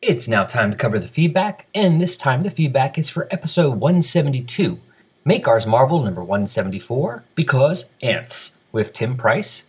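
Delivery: brisk, emphatic podcast announcer delivery; scripted announcer read. Acted. Host reads feedback and listener names to the audience, announcer style. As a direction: read it out crisply with swinging, sing-song list intonation.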